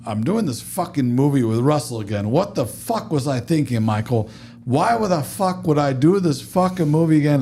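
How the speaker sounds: heated retold rant, rising in pitch; going off. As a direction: imitate a director ranting in disbelief. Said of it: "he's just going off".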